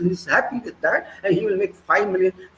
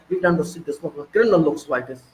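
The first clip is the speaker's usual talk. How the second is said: ordinary talk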